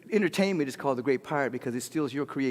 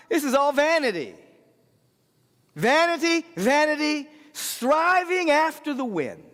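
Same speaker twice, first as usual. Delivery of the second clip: Solomon's lament; 10 semitones higher, 5 dB louder than usual. Retold or imitated. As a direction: draw out the vanity like an old king's sigh. Preacher voicing Solomon's lament.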